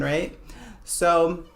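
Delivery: usual speaking voice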